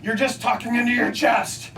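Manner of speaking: high-pitched shouted complaint; imitating an irritated heckler; audience heckler. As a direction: heckle the speaker from the seats. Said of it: "and she says".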